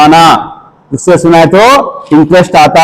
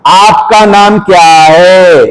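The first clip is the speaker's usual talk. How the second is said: slow demo; 3 semitones higher, much slower than usual. Shown as a demonstration. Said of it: "बोलो".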